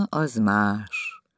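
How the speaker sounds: plain reading voice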